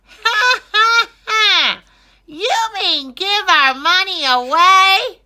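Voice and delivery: loud high scornful laugh and sneer; mocking aristocrat. Greedy noble scoffs at the king, loud mocking laugh.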